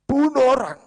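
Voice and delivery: very high, loud shocked outcry; acted scandalised yell; aghast exclamation. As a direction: shriek it back in horrified disbelief. Barsiso aghast at the suggestion.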